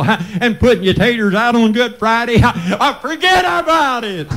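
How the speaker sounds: high, chanted sermon with gasped ha; rhythmic preaching. Real, not acted. Country preacher chanting his sermon to the church. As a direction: ride the preaching rhythm, breath on every ha.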